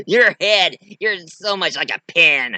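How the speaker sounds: mock the opponent after a cackle; cackling doll's gleeful jeer. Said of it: "and it was like".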